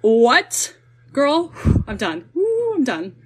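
loud, high-pitched disbelief, drawn out; incredulous reaction. Retold or imitated. Reenacting her reaction to a shocking video, incredulous.

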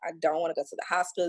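ordinary talk, nothing marked

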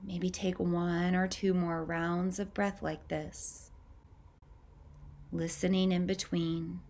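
calm, unhurried meditation voice with long pauses; guided meditation. Acted. Guiding listeners to tune into their breath, calm meditative voice. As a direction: ease the listener into noticing each breath.

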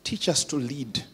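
closing prayer for leaders. Acted. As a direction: pray it humbly.

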